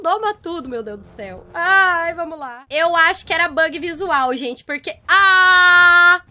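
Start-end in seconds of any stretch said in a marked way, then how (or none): 5.1-6.2 loud, drawn-out yell of alarm; startled scream. Real, not acted. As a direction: scream out mid-sentence. Attacked mid-sentence.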